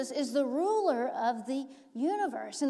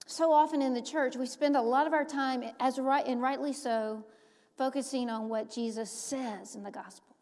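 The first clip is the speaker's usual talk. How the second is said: normal talking voice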